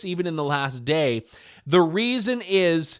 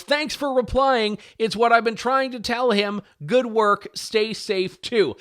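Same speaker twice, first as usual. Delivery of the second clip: higher-pitched, perky imitation of thanks; mock-grateful; 6 semitones higher than usual. Woman thanking police who backed her against husband, mock-grateful. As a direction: read it as an over-appreciative reply.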